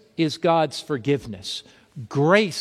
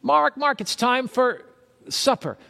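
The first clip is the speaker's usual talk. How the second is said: wife calling; 7 semitones higher than usual. Retold or imitated. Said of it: "my wife calls me".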